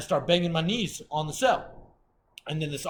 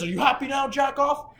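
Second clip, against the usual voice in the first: high, loud mocking imitation of the guard; shouted taunt; 11 semitones higher, 6 dB louder than usual. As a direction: taunt the prisoner loudly. Officer's taunt, acted out.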